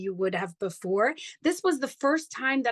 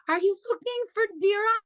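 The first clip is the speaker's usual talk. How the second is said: high, louder, quick friendly greeting; host greeting a lost guest, higher and brighter; imitating Suchitra's welcome; 9 semitones higher, 5 dB louder, faster than usual. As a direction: mimic a sweet host calling from the driveway. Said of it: "she comes out and she goes".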